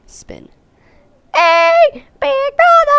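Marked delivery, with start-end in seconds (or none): 1.3-3.0 piercing, loud little-sister holler; high-pitched calling. Acted. Game sister calls to her brother, very high drawn-out shout. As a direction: call out very high, holding the last word.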